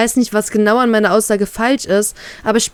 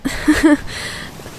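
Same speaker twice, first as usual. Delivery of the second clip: breathy laugh through tears; teary unvoiced laugh; 6 semitones higher than usual. A narrator's reading. Woman laughs tearfully at his reply. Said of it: "lache ich mit Tränen in den Augen".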